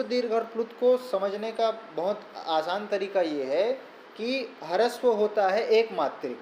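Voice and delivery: usual speaking voice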